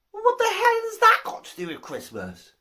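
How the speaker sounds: lightly acted incredulous demand; mock exasperation. Saint Peter challenges the second man.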